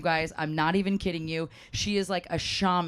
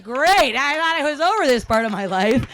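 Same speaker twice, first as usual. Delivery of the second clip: exasperated outburst; 8 semitones higher, 10 dB louder than usual. Retold or imitated. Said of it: "And then you're like".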